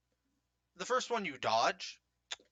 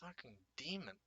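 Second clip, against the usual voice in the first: mumbling; 14 dB quieter than usual. Acted. Game master voices a guard mumbling about the player, very softly. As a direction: mumble it quietly to yourself in awe. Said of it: "hear one of them mumble".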